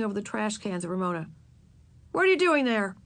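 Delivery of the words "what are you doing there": raised-pitch demanding question. A narrator's reading. Henry peering at Ramona behind the cans, demanding. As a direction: demand an explanation. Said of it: "he demanded".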